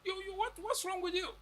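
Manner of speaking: as if someone broke an unspoken rule; incredulous outburst. Retold or imitated. South African reacting to the faux pas.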